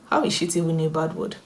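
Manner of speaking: ordinary talk, nothing marked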